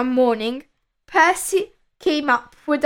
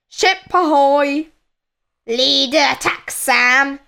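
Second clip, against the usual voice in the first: pirate captain calling out; a hint of a commanding pirate; Captain Crook ordering. A narrator's reading. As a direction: call the crew to action. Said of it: "ordered Captain Crook".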